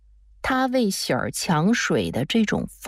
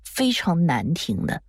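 ordinary delivery, nothing marked

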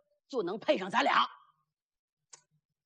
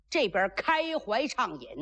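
ordinary delivery

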